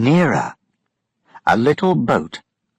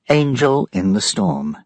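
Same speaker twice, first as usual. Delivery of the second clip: usual speaking voice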